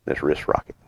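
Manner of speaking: ordinary delivery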